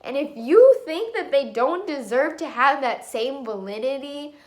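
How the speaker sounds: ordinary delivery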